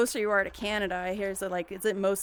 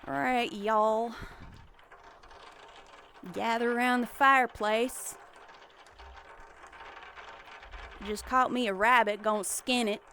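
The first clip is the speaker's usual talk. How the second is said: mock Southern. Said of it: "I definitely say y'all".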